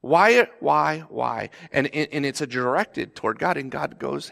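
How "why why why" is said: high, loud pleading cries of why; anguished shout at God. Retold or imitated. Frustrated believer crying out to God, loud and anguished. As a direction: hurl the questions up at God in frustration. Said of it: "your heart rate".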